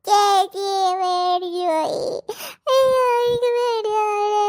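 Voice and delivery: slow, loud frightened sobbing; little girl sobbing to her big sister; crying from fright. Acted. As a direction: wail like a scared child. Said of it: "കിടന്ന് മോങ്ങണ എന്തിനാ".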